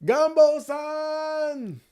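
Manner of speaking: "Gumbo san!" very high and slow, stretched out; high drawn-out shout. Shown as a demonstration. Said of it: "I didn't say that right".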